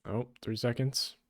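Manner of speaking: normal talking voice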